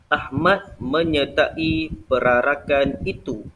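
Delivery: slow, clear dictation of the example; slow example sentence. Shown as a demonstration. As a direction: read it out slowly so pupils can copy. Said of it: "Okey, contoh". (ayat contoh dibaca perlahan)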